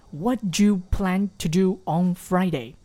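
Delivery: slow but smoothly linked; slow linked reading. Shown as a demonstration. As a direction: link the words even slowly. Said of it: "那我用连读的方式呢，即使读得很慢". (慢速连读)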